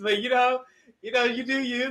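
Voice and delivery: usual speaking voice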